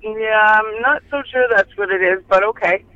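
ordinary talk

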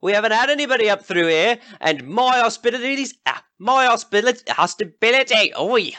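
high, pushy yokel calling out; brash rival villager. Acted. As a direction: shout down a rival host. Rival villager Jockey hails travellers.

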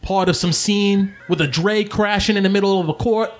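high-pitched friend reporting gossip; Sly, teasing. Acted. Sly showing Domino the broadsheet, teasing. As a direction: read it out high, needling him.

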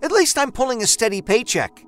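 angry argument. A narrator's reading. Husband and wife quarrelling over money, heated and loud. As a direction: defend yourself hotly.

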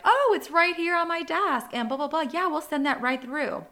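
high, bright imitation of a clerk; insurance lady voice. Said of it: "the insurance lady was like".

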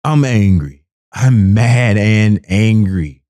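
ordinary delivery

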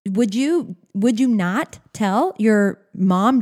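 ordinary talk

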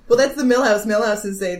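ordinary talk, nothing marked

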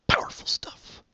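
hushed breathy whisper. Acted. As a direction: breathe the words out with no voice. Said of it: "repeats phrase in a whisper".

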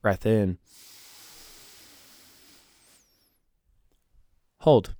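calm, soft breathing cues. Acted. Leads a breathing exercise.

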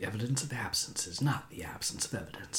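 hushed, mock-profound saying; whispered quotation. Quoting a saying to co-host about evidence, whispered. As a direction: whisper it like a wise aside.

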